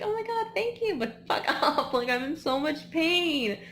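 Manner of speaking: high, loud, strained thanks-but-go-away; grateful but fed up, pushed up high; exhausted runner snapping back at the crowd; exasperated runner. Retold or imitated. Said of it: "And you're like".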